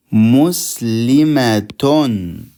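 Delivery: Muslimatun drawn out slowly, each syllable clear; slow Arabic model word. Shown as a demonstration. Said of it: "Muslimatun when we join".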